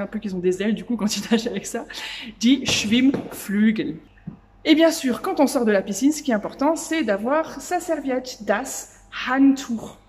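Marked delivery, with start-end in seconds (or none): 2.4-4.0 "Schwimmnudeln" said slowly and louder; teacher presenting a German word slowly and loudly; loud slow demo. Shown as a demonstration. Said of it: "Je vais t'apprendre du vocabulaire". 8.4-10.0 "das Handtuch" drawn out slowly and louder; teacher modelling a German word slowly; slow model word. Shown as a demonstration. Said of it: "Je vais t'apprendre du vocabulaire".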